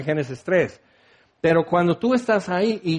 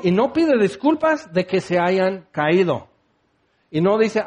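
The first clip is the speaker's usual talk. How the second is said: ordinary talk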